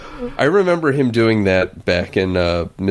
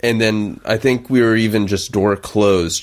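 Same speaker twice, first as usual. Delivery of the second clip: usual speaking voice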